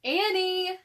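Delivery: loud, high call of a name; shouting. A narrator's reading. Brother shouting for missing sister, loud call. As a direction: shout her name into the woods. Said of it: "he shouted again".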